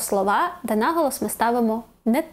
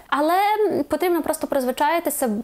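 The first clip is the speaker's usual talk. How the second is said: ordinary talk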